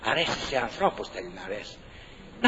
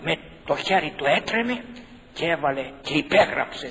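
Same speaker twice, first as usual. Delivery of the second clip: ordinary talk, nothing marked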